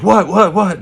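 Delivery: high, loud panicked dad asking; shrill panic at his son; alarmed dad. Retold or imitated. Dad demanding answers from son, high loud alarm.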